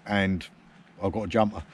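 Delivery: normal talking voice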